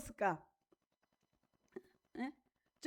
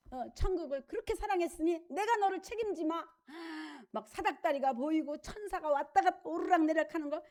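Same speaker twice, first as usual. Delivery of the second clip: usual speaking voice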